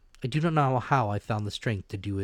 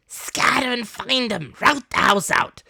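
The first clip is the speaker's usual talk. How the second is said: repeated order; 10 dB louder than usual. Acted. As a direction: drive them through the house. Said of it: "reiterated Pew".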